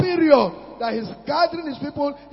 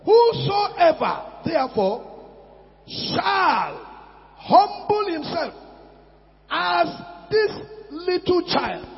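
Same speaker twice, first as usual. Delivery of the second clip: declaimed scripture; 5 semitones higher, slower than usual. Real, not acted. Preacher declaims scripture loudly.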